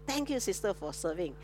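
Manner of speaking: heartfelt thanks. Retold or imitated. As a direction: quietly thank them as you shake hands. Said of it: "and say".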